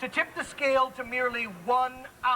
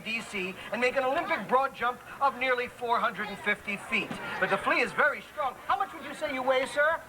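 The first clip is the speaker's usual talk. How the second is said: ordinary talk, nothing marked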